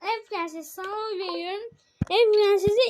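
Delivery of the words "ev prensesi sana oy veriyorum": normal talking voice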